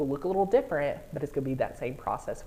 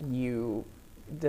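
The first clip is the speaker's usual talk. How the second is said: normal talking voice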